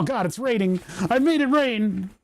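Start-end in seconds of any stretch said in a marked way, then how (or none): 1.1-2.1 excited. Real, not acted. Money gun sprays bills everywhere.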